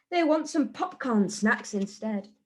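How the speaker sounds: ordinary delivery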